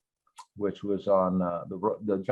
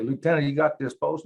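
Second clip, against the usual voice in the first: hammed-up Texas accent, higher; 6 semitones higher than usual. Shown as a demonstration. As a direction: deliver the line in a Texas accent. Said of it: "I'll use my Texas accent".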